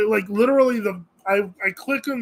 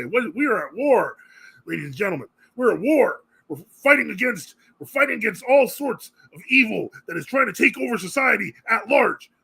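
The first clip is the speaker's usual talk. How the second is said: raised-pitch, hammed-up war speech; rally the troops with mock fervour; 5 semitones higher than usual. Streamer rallying viewers against evil, mock rallying speech.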